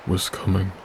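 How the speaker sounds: plain reading voice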